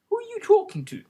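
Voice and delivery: high, curious royal voice; a lightly voiced inquisitive king. A narrator's reading. King questioning Alice.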